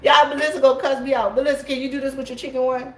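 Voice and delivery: fast, high, loud and giggly; laughing. Real, not acted. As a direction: rush it out between laughs. Teasing while laughing.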